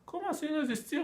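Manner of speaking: high, incredulous student objection; voicing an astonished student; mock student surprise. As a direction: pitch it up like a baffled student asking back.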